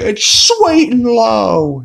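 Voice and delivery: high, slow, loud cry; hammed-up retold yell; mock shout. Neil shouts back about the coffee.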